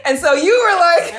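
laughing through the words; giggling as she speaks. Real, not acted. Laughing while retelling.

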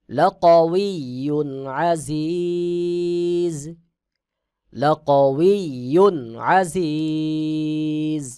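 slow melodic tilawat, long held notes; Quran recitation. Shown as a demonstration. Teacher recites the verse melodically for students. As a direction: chant it slowly, sustaining the vowels. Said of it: "সহি উচ্চারণ হবে".

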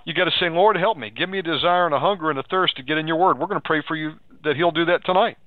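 ordinary delivery

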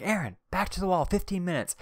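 hammed-up teacher sending a kid to the wall; teacher ordering Aaron back to the wall, mock scolding; stern teacher. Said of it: "The same teacher's like".

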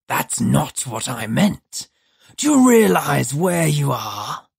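slow, harsh creature voice; the demon presses Judd, slow and harsh. A narrator's reading. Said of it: "harsh as pumice on broken glass".

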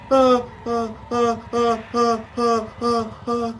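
high, rhythmic frightened cries; string of short scared ah sounds; panicked yelps. Real, not acted. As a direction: yelp over and over while fleeing. Fleeing after jump scare.

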